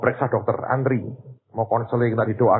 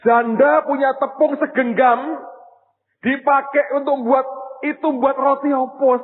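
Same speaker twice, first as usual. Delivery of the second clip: impassioned preaching; 13 semitones higher, 3 dB louder than usual. Acted. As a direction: preach it at full force. Impassioned preaching at full force. (khotbah berapi-api dengan suara tinggi dan lantang)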